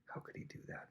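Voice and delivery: whispered disbelief, looking down on him; imitated disdainful whisper. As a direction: whisper it in mock disgust. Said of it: "looks down upon him".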